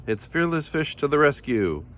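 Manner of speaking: high heroic announcement; shouted. A narrator's reading. Fearless Fish announces her rescue, high heroic call. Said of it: "shouted Fish".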